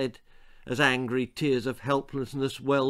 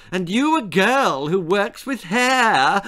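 teasing hairdresser; 7 semitones higher, 7 dB louder than usual. Acted. Sandra teasing Esmeralda.

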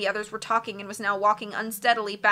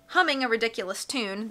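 usual speaking voice

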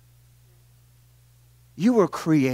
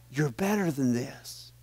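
quietly spoken wife; 2 semitones lower, 5 dB quieter than usual. Retold or imitated. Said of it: "And in quietness, she says".

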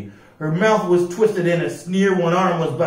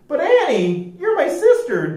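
much higher whimpering protest; blubbering; 13 semitones higher than usual. A narrator's reading. Joe blubbering to his sister, high whimpering. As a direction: blubber out the plea. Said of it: "Joe blubbered".

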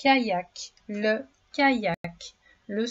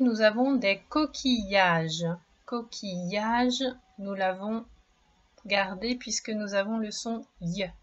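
normal talking voice

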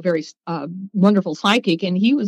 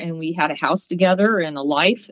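ordinary talk

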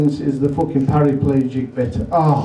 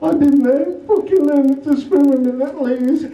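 high, laughing mock-tearful insult; the swimmer with only a head bawling at the manager; crying swimmer; 10 semitones higher than usual. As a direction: wail it like a hurt kid. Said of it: "He said".

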